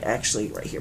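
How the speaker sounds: normal talking voice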